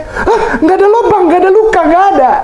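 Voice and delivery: high startled exclamation; shocked gasp. Retold or imitated. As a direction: gasp it out as if shocked to find nothing. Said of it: "Baru Thomas kaget".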